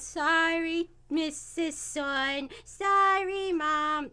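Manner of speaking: slow, drawn-out chorus-style apology; sheepish kids apologizing. Acted. Everyone apologizing to Chi-Chi, sheepish kid voices. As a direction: drag it out like scolded children.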